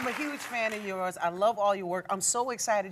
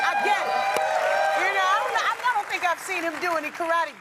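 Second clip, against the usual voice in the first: teasing; 9 semitones higher, 6 dB louder than usual. Pretend. Host teasing, high and loud.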